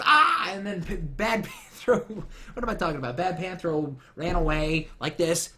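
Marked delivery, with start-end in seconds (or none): 0.0-0.5 high loud mock cry; retold cry. Evil Panthro burned by sword light, mock cry. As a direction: yelp like a cartoon villain burning. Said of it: "and it was".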